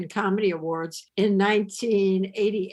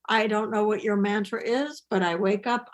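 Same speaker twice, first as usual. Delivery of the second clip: ordinary talk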